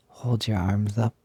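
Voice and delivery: ordinary talk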